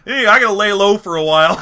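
high, loud, brash impression; Joe Biden. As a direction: burst in with goofy bluster. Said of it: "I'm Diamond Joe Biden".

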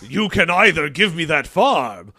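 slow villain voice with a laugh; mustache twirling villain. Acted. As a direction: gloat like a melodrama villain. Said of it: "twirling a mustache".

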